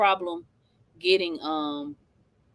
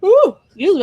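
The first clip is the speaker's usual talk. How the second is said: yawning; 9 semitones higher, 7 dB louder than usual. Real, not acted. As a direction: yawn breaking through the words. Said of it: "I keep yawning".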